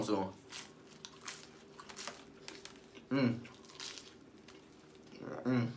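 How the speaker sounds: chewing while humming; eating a sandwich; mouth full. Real, not acted. Seen 0: bites bun at 0.6–1.0, then chews with closed, full mouth.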